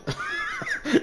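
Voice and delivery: high shrieking burst of laughter; a high-pitched laughing shriek. Real, not acted. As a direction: crack up in a high squeal. Caster laughs at player's position.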